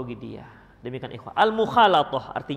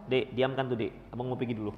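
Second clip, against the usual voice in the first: low, soft brush-off to the wife; offhand husband; 7 semitones lower, 6 dB quieter than usual. Acted. Father telling wife to quiet the child, lower and softer. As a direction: hand off the crying child and go, low.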